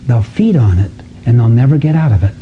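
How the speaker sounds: ordinary talk, nothing marked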